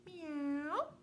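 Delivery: high, drawn-out meow; cat meow. A narrator's reading. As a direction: meow like a cat, rising at the end. Imitating cat in read-aloud story.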